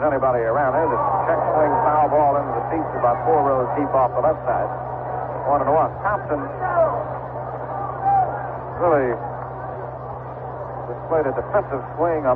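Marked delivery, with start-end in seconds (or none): none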